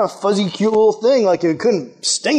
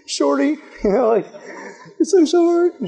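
taunting; 6 semitones higher than usual. Pretend. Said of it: "you rub it in their face".

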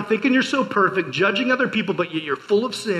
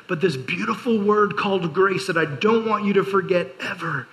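ordinary delivery

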